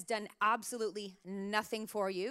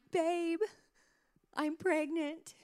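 mock-weepy confession, higher pitch; 假装带哭腔; 6 semitones higher, slower than usual. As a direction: tearfully break the news, slow and hesitant. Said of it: "started to get a little weepy".

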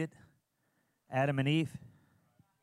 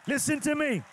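full-force preaching; 6 semitones higher than usual. Acted. Pastor preaching giving to congregation, rising to full force.

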